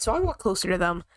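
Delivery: ordinary talk, nothing marked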